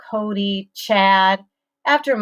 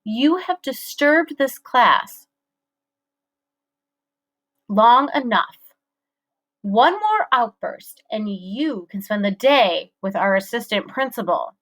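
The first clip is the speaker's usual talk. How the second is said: stern; 4 semitones higher than usual. A narrator's reading. Teacher warning.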